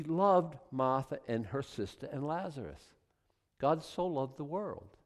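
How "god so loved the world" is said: ordinary talk, nothing marked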